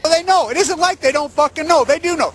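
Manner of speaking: high-pitched, exasperated rebuttal; indignant insistence. Real, not acted. Ranter answering the agency's report, indignant insistence.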